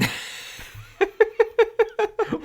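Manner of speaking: long high-pitched laugh. Real, not acted. Laughing at his own joke.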